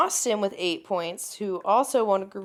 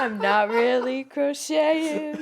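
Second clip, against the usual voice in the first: laughing; 4 semitones higher, slower than usual. Real, not acted. Laughing while denying.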